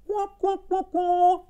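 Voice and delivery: high mocking "guac, guac" noise; wah-wah. Shown as a demonstration. Imitated wrong-answer noise. («guac, guac» agudo de fallo)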